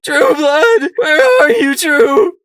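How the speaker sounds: very high, very loud sobbing shout; wailing cry. Acted. Sunflower crying out for her lost husband, wailing. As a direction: wail for him through tears. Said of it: "she wailed".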